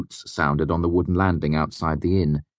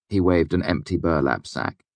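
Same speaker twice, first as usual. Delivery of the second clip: ordinary delivery, nothing marked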